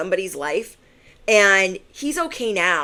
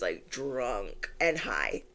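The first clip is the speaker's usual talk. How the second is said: usual speaking voice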